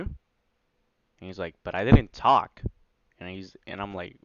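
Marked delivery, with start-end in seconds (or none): 1.6-2.6 much louder, insisting he said nothing; emphatic denial. Retold or imitated. Voicing his brother who insists he never spoke, loud denial. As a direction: push hard on didn't, protesting.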